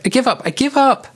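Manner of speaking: very high squeal of surrender; playful cry. A narrator's reading. Tickled lioness surrenders to Simon, high playful cry. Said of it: "she cried".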